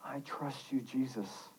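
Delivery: hushed, whispered trust. Acted. Reader voicing a believer's prayer to Jesus, whispered. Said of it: "look to me and whisper".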